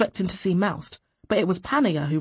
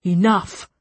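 loud, sudden command; barked; 5 dB louder than usual. A narrator's reading. Chief officer silencing prisoner, barking. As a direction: cut them off with a bark. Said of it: "the chief officer barked".

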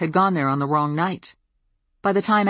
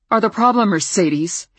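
sharp, loud angry snap; snapped; 5 semitones higher, 5 dB louder than usual. Acted. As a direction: spit it at her. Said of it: "she snapped".

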